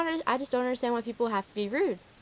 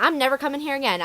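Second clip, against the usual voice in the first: higher mock-outraged vow; yelling mom; 6 semitones higher, 4 dB louder than usual. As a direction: imitate an angry customer storming off. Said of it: "the mom's still yelling at me".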